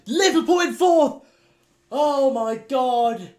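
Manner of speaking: loud, high disbelieving cry; shocked yell. Real, not acted. Champions predicted fourth.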